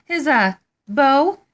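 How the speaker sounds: high, loud, flustered stammering. Acted. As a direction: scramble for an answer, caught out. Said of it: "they stammered in surprise".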